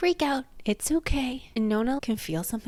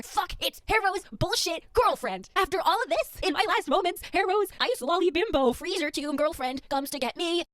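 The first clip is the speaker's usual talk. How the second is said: high, put-on exasperated voice; mock outraged character voice; Gideon's inner voice; 8 semitones higher than usual. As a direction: sneer it like a fed-up dying swordswoman. Voicing Gideon's inner thoughts.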